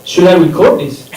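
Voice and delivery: usual speaking voice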